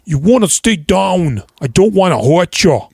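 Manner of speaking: mock a cocky Irish fighter; cocky boxer. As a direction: boxer warning the downed man. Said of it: "and he".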